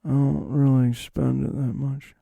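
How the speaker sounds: slow, soft, understated loner impression; Nick Cage. Truffle seller asked about money, slow understated impression. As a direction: answer slowly, giving little away. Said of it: "That's my Nick Cage impression".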